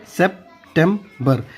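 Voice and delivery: "September" slowly as sep... tem... ber; broken into syllables. Shown as a demonstration. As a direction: break "September" into three parts for learners. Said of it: "वैसे याद करना है तोड़ तोड़ के".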